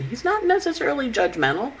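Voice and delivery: ordinary talk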